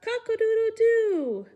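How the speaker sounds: high, loud rooster crow; full-throated cock-a-doodle-doo. Pretend. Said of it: "like a rooster".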